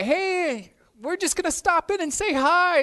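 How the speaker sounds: high, bright-voiced friend phoning ahead; chirpy caller. Friend calling to announce a visit, chirpy high voice. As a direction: play the cheery friend inviting herself over. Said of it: "Someone calls and say".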